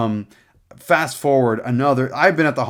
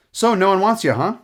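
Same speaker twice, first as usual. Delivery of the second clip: higher, louder, flippant mock delivery; imitating a blunt, offhand doctor; overly casual doctor; 9 semitones higher, 5 dB louder than usual. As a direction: toss it out far too casually. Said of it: "the way he was doing it".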